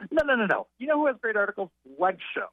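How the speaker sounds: high, insistent comic imitation; Gilbert Gottfried. Comedian pitching dirty magazines to the writer, rough imitation. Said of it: "I will do the worst imitation".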